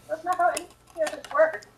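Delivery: normal talking voice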